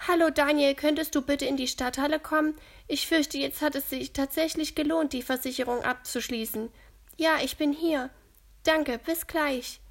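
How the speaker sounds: ältere Dame, etwas höher und lauter gesprochen; bekümmerte alte Frau, leicht gespielt; Gertrud voiced. A narrator's reading. Gertrud on the phone. (Gertrud gesteht den Kindern, leicht gespielte alte Dame)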